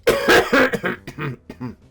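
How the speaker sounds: loud hacking cough; coughing fit. Real, not acted. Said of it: "That, like that".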